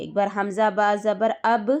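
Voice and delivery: ordinary talk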